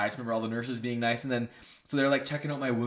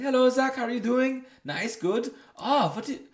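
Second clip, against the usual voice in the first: higher, louder mock Eastern European doctor; Eastern European accent; 13 semitones higher, 6 dB louder than usual. Doctor questioning a rollerblading patient, hammed-up Eastern European accent. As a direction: butcher an Eastern European accent, pitched up and loud. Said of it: "this Eastern European guy".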